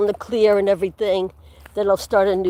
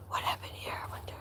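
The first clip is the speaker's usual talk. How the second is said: unvoiced wondering whisper; 19 dB quieter, faster than usual. Real, not acted. Vlogger whispering while finding storm damage. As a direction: hush the question to yourself. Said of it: "I'm whispering".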